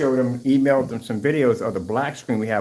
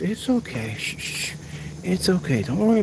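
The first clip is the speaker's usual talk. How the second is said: soft hushing reassurance; calm the bird, soft and gentle; gentle hush to a frightened bird; soothing the bird; 7 semitones higher, 3 dB quieter than usual. Real, not acted.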